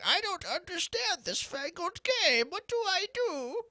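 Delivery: high, slow, creaky grandpa voice; old man voice. Retold or imitated. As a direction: put on a shaky old man voice. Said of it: "that's my old man voice".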